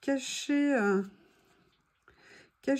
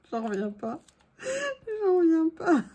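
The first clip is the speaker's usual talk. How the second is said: laughing disbelief; 5 semitones higher than usual. Real, not acted.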